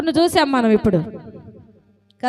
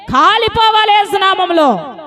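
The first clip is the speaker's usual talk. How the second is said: fervent rebuke; 6 semitones higher, 4 dB louder than usual. Acted. Fiery prayer rebuke.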